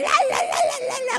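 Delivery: mother's tongues pitched high and loud. Retold or imitated. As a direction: ecstatic high chanting. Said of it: "minha mãe".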